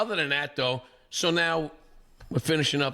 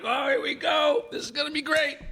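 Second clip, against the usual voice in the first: gleeful excitement. Retold or imitated. Host grabbing the camera to film the fight, gleeful. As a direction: relish the coming fight.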